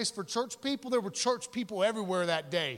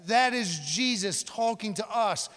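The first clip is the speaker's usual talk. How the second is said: normal talking voice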